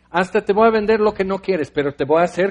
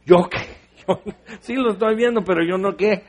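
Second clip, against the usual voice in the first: "¿yo qué?" entre risas; sorpresa risueña; 2 semitones higher than usual. Retold or imitated. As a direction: hazte el desconcertado riendo. Se imita sorprendido ante el niño. (el predicador desconcertado, riéndose)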